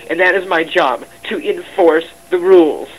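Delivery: loud, emphatic self-defence; forceful insistence. Real, not acted. Staff member justifying the job of enforcing rules, forceful. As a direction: hammer the point home loudly.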